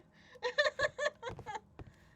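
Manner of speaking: loud high laugh; high burst of laughter. Real, not acted. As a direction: break into a big laugh. Laughs at memory.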